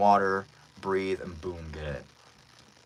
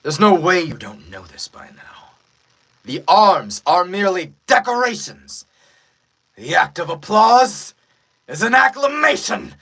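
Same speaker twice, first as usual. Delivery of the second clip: rising higher and louder, declaiming; anime villain build; 9 semitones higher, 10 dB louder than usual. Acted. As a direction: build from taunt to full proclamation. Dubbing an anime battle line.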